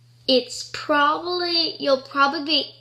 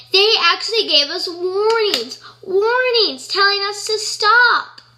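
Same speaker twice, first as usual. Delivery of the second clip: raised, louder, emphatic protest; impassioned plea; 5 semitones higher, 5 dB louder than usual. Real, not acted. Defending blamed pit bulls to viewers, impassioned. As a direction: plead their case, high and forceful.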